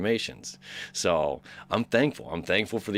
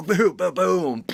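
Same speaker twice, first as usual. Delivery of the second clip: higher-pitched mouthed theater booms; booming sound effects; 9 semitones higher, 4 dB louder, slower than usual. Retold or imitated. Imitating surround-sound effects in the theater, booming. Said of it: "all those sound effects come in".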